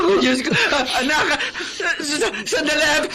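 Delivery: flustered, alarmed cry for him to wait; panicked alarm. Acted. Mother seeing her son's nosebleed, panicked cry. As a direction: rush in, frightened for her son. Son's nose starts bleeding.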